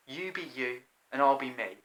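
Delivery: plain reading voice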